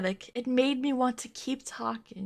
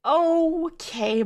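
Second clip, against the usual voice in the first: higher, drawn-out sarcastic okay; 6 semitones higher, 4 dB louder than usual. Shown as a demonstration. Rereading his reply, pitched up in disbelief. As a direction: say it with a mocking lift.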